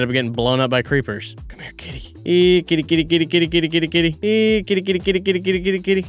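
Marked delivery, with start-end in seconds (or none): 1.5-2.1 hushed whisper to lure a cat; breathy whisper to a kitty; whispered coaxing. Real, not acted. Softly coaxing the cat closer. 2.3-6.1 singsong kitty call, a bit higher; higher, sweet calling voice for a cat. Real, not acted.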